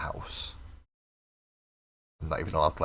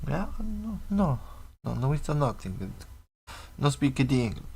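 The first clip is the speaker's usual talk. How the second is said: put-on Scouse accent; 7 semitones higher than usual. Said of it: "Mancunian doing a Scouse accent".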